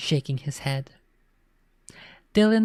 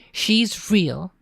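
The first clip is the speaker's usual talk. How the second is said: mild scream; 6 semitones higher than usual. A narrator's reading. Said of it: "she screamed".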